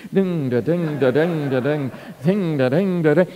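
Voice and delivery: playful sing-song dings like a pinball machine; imitating a pinball machine going off; pinball sound effect. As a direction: bounce the dings like a pinball racking up points. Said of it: "you ever seen those little pinball machines".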